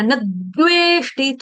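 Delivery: "न द्वेष्टि" as nad-dveshti, slow, with the द pressed; pressure on d. Shown as a demonstration. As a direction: hold the tongue on द in "न द्वेष्टि" before releasing. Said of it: "थोड़ा दबाव आए". ("न द्वेष्टि" में द पर मुंह में दबाव)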